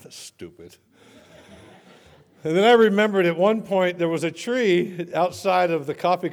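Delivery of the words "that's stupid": much lower and softer, dismissive; scornful thought. Retold or imitated. Privately scoffing at praying to the ocean, muttered. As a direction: mutter it under your breath.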